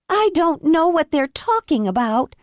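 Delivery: high, pleading fake-tearful woman; fake victim plea. Karen feigns innocence.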